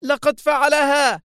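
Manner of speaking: high, drawn-out outburst of anger; angry cry. A narrator's reading. Woman seeing her camera screen die, crying out angrily. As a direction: cry out slowly, high and furious. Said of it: "تهتف في غضب".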